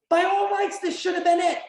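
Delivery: high, projected commentator impression; Michael Cole call. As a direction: call it out like a big-match announcer. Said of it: "Michael comes in again with just".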